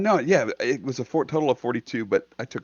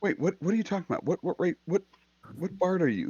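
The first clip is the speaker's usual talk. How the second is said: confused surprise; 6 semitones higher, faster than usual. Real, not acted. Confused by bardic rule.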